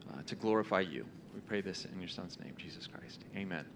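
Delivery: soft, reverent prayer that fades to a near whisper; closing prayer. Acted. Pastor praying softly for the church, fading to a hush at the end. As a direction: pray gently over the congregation.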